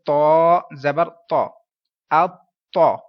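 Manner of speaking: long slow تو, then اتو with a held t; model spelling-out. Shown as a demonstration. Teacher models the way of reading, slow firm اتو. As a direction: draw out تو, then punch اتو with its doubled t. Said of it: "یہ پڑھنے کا طریقہ ہوا".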